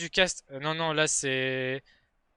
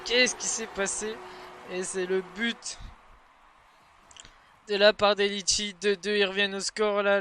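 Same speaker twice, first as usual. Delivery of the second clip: fast, excited play-by-play; esports caster; 5 semitones higher, 6 dB louder than usual. Acted. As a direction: hype up every goal. Live Rocket League match commentary.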